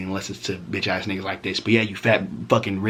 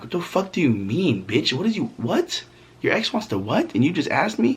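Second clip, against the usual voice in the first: pitched-up incredulous demand; outraged disbelief; 7 semitones higher than usual. Retold or imitated. To his girlfriend about her ex, in high incredulous outrage. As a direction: act stunned and offended.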